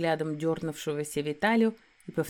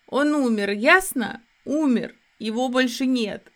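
higher, louder, sobbing cry; tearful outburst; 7 semitones higher, 7 dB louder than usual. Acted. Heroine cries at the werewolf that he is dead, tearful outburst. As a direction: hurl the grief at him in tears.

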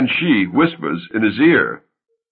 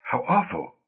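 hushed, much softer; moved; 9 dB quieter than usual. Acted. Wife hearing of a murder, hushed and moved. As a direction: say it under your breath, shaken. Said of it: "Genevieve, moved".

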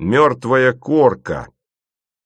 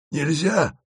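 higher, smiling sympathetic refusal; sympathetic smile; 6 semitones higher than usual. A narrator's reading. Asen repeats the refusal, smiling sympathetically. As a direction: say it with a kind smile. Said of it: "повторил Асен и сочувственно улыбнулся".

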